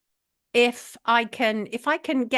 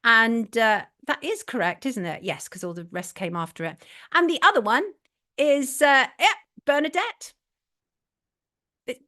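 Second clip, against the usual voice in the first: ordinary talk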